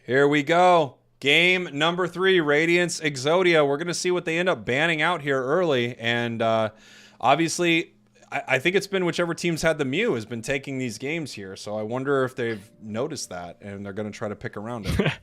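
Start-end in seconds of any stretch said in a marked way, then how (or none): 0.0-11.5 louder, higher caster read of the draft; play-by-play. Acted. Opening game three for the audience, energetic play-by-play. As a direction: bring the match in like a live caster. Casts the match start.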